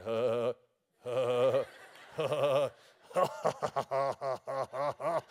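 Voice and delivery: low rhythmic chuckles; imitated holy laughter; mock chuckle. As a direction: chuckle low like the old preacher.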